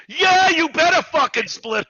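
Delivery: high, loud, drawn-out mock holler; retold eager yell. Answering a player about splitting, exaggerated yell. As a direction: yell it back as if it's obvious.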